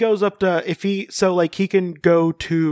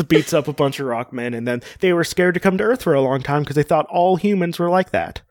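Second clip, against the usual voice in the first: normal talking voice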